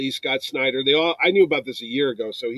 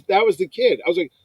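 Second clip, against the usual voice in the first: ordinary talk, nothing marked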